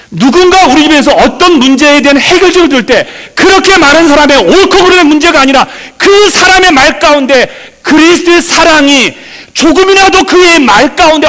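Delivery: loud, high-pitched pulpit exhortation; impassioned preaching. Real, not acted. Pastor warning that merely right words hurt people, impassioned preaching. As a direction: drive it home to the congregation, high and loud.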